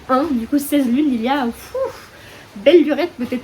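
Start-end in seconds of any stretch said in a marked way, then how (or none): 1.4-2.1 soft puff of air through the lips; puffed-out breath. Real, not acted. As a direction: blow out a long "pfff". Puffing at how long ago.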